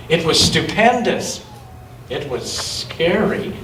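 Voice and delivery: plain reading voice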